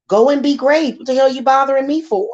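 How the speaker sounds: exasperated comeback to the bragging narc; indignant retort. Retold or imitated. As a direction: toss it back at him, exasperated. Snapping back at the narc.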